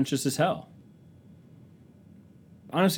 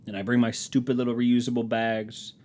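ordinary delivery

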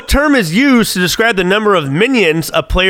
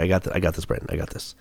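real, softer murmur to self; quiet self-talk; 10 semitones lower, 12 dB quieter, faster than usual. Real, not acted. Concentrating on the trivia answer, quiet murmur. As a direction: keep it low, talking yourself through it.

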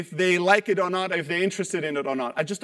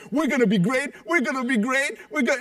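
mock pep talk; 5 semitones higher than usual. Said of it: "sit in front of a mirror and go".

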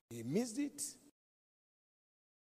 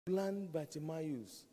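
ordinary talk

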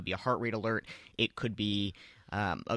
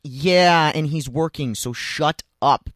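louder, slow snap; firm put-down; 6 semitones higher, 10 dB louder, slower than usual. Retold or imitated. Telling the heckler to back off, firm put-down. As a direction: shut him down flatly.

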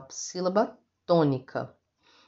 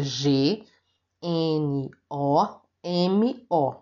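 G N O M O spelled slowly, letter by letter; slow spelling; 2 semitones lower, much slower than usual. Shown as a demonstration. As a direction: spell gnomo out for the class. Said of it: "a palavra gnomo".

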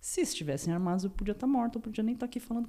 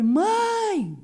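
high, loud yell for mom; retold scream; 13 semitones higher, 9 dB louder than usual. Victim calling her mother from the gate, acted high scream. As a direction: re-enact a long scream for mother. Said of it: "a primeira coisa que eu gritei foi".

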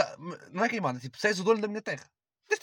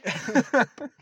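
sudden burst of laughter; loud laugh; 6 semitones higher, 7 dB louder than usual. Real, not acted.

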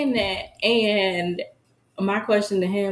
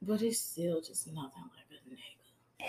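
whisper; 5 semitones lower, 15 dB quieter than usual. Real, not acted. Both drop to a whisper.

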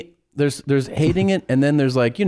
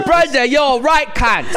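high, slow mock British insult; British accent; 12 semitones higher, 3 dB louder, slower than usual. Friend showing off his British accent to Brendan. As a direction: lay on a thick British accent. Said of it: "you're the master of accents".

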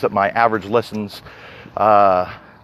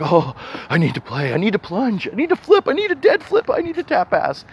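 frantic, climbing higher and higher; desperate craving; 9 semitones higher than usual. Retold or imitated. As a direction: beg for it as the pitch soars. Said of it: "You're like".

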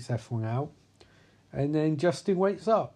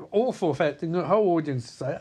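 usual speaking voice

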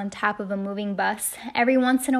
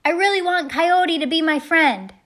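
high, loud yell all through; big holler over the wind; shouted confession; 7 semitones higher, 6 dB louder than usual. A narrator's reading. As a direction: shout a hopeful secret into the night. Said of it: "and then he shouted".

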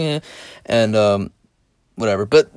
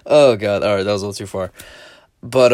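ordinary delivery

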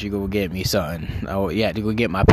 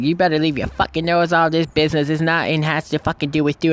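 higher, lightly imitated scolding woman; 5 semitones higher than usual. Mom telling the storyteller to stay out, lightly imitated woman voice. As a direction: pitch up a little for a woman's telling-off. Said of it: "I'm gonna do a woman voice".

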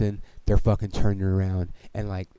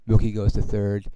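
usual speaking voice

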